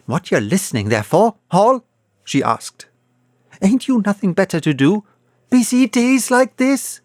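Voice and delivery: fast, high nagging scold; scolding wife. A narrator's reading. Wife scolds Hall for idle listening, fast and sharp. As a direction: nag him hard and fast.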